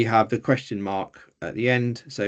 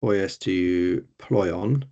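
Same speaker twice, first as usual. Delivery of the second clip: poies to ploion said very slowly, word by word; slow careful reading of poies to ploion; slow Greek reading; 2 semitones lower, much slower than usual. Shown as a demonstration. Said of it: "we'd written it as".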